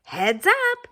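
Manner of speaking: mother duck announcing the hatching to all; excited Mother Duck. Acted. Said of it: "Mother Duck quacked".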